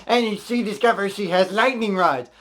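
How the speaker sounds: ordinary talk, nothing marked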